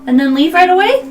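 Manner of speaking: high, loud bug voice; suspicious little beetle. Acted. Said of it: "You hear in beetle speak".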